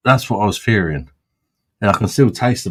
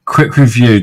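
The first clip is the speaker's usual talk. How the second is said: posh voice; 3 semitones higher, 9 dB louder than usual. Pretend. Asked by the friend to review in posh style. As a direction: put on a posh wine-critic voice. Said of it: "do it posh style though".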